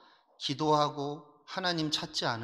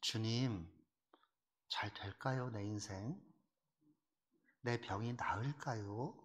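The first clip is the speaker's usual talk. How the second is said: humble quiet prayer; 9 dB quieter than usual. Retold or imitated. Believer softly praying to the Lord about illness and children. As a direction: pray quietly, laying worries before God. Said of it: "주님께 물어야죠".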